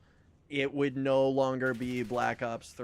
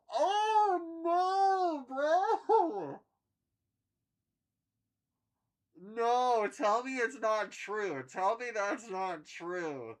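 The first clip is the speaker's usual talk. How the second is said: anguished denial; 9 semitones higher, much slower than usual. Real, not acted. Realizing he'll be near fifty.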